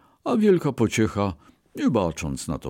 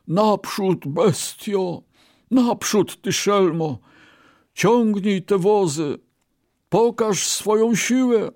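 high, forceful driving yell; commanding shout; 12 semitones higher, 3 dB louder than usual. A narrator's reading. Poor Brahmin lashing his ox before the crowd, shouting. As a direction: shout orders at a stubborn beast. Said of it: "i krzyknął".

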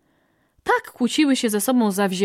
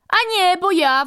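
high-pitched squabbling yell; 10 semitones higher, 4 dB louder than usual. A narrator's reading. Another quail claiming to be leader, yelling. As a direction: butt in with a high, insistent yell. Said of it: "wrzasnęła inna".

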